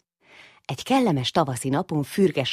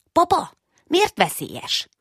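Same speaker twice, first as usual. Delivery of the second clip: put-on much higher, slow child's question; high little child; 5 dB louder, slower than usual. Little Ödönke asks his father why, high and slow. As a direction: ask papa slowly, wide-eyed.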